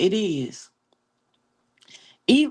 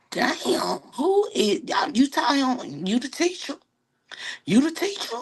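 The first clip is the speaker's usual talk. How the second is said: mock surprise; 8 semitones higher than usual. Teasing friend about his teacher.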